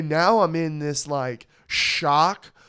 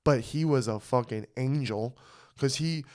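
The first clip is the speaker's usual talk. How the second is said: ordinary talk, nothing marked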